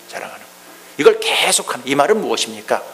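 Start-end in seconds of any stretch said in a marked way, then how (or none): 0.1-0.5 hushed echo. Shown as a demonstration. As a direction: repeat the phrase almost silently. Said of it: "echo repeat". (속삭이듯 작게 반복)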